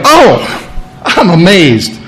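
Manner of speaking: high, gushing fake surprise; hammed-up astonishment; mock amazement. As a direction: play it as if caught off guard. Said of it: "He wasn't surprised".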